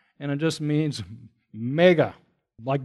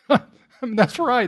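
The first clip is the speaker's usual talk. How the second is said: usual speaking voice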